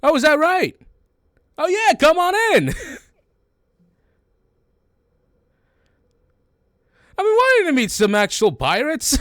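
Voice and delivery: very high, loud, eager creature voice; jolly beholder captain. Acted. Beholder captain welcoming pirates into its lair, high excited voice. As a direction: pipe up loudly, welcoming and excited.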